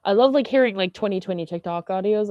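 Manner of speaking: ordinary talk, nothing marked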